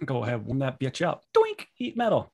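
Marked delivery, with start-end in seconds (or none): 1.3-2.3 gleeful spell cast. Acted. Player casts heat metal.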